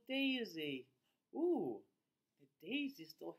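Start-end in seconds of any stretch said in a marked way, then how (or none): none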